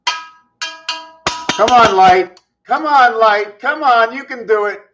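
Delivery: high, loud cheering on; eager coaxing. Real, not acted. Speaker cheering on a flickering light, high and eager. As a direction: urge it on like a stubborn pet.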